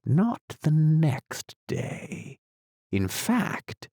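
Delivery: slow, high, musing; slow and thoughtful. A narrator's reading. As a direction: ponder it aloud slowly. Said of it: "the knight repeated as before".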